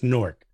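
Newark squashed into one syllable, Nork. Shown as a demonstration. Teaching the local way, Newark said as Nork. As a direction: Newark rhymed with cork. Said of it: "we don't pronounce it that way".

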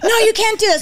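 amused imitation of a shocked correction; laughing retold objection. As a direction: cut the offer off through laughter. Ladies object to her offer.

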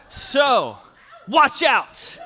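loud, playful boast; mock bravado. Pastor hyping his analogy to the congregation, loud mock bravado. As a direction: announce yourself with swagger. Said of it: "today I am Mister Analogy".

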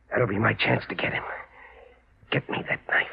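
hushed, voiceless plotting; whispered intent. Acted. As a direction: whisper the plan close. Whispered plan of revenge.

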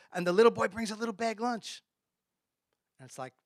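ordinary talk, nothing marked

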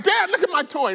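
high, eager child imitation; loud, high-pitched little-boy voice; excited son. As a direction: show off like a thrilled kid. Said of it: "And he's like".

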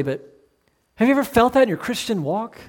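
ordinary talk

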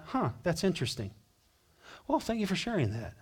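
quick, offhand and dismissive; indifferent shrug. Pretend. Preacher mocking a careless listener, offhand and dismissive. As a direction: brush it off lightly. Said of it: "The right response is not".

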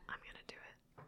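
playful secretive whisper; hushed, mock-sinister whisper; conspiratorial whisper. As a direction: whisper it like a sneaky plot. Playful plotting of a sneaky haircut.

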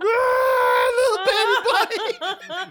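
high, loud, slow mock wail; mock crying kids. Five-year-olds crying over the bunny, hammed-up wail. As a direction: wail it like upset children, hammed-up.

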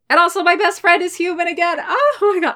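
high-pitched gush of excitement; excited. Real, not acted. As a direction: gush about it, voice shooting up. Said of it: "I'm so excited".

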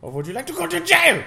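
high, loud hammed-up threat; hammy Hollywood German officer barking a threat; mock German threat. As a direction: yell it like a cartoon villain officer.

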